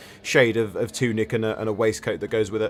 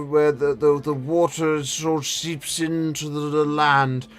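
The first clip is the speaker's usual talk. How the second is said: put-on old man voice; 3 semitones higher than usual. GM continues old wizard NPC.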